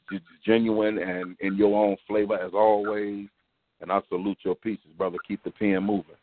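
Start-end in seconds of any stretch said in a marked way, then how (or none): none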